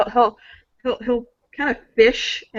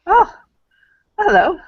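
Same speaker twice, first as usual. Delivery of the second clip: high cheerful greeting; 11 semitones higher, 5 dB louder than usual. Acted. Character greets, high and loud.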